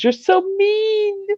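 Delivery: put-on very high, slow sing-song child whine; imitating little daughter. Mother quoting her daughter's sulky whine. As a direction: drag it out like a pouting small girl. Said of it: "she goes".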